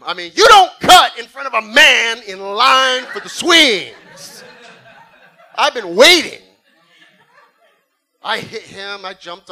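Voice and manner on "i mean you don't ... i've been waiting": loud laughing indignant shout; slow, mock-righteous bellow; laughing outrage. Ninja kid justifying the punch, loud laughing shout. Defending cutting in line.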